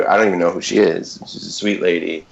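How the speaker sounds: normal talking voice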